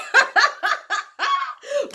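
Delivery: high burst of laughter after singing; real giggle at own brag. Real, not acted. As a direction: laugh at your own joke.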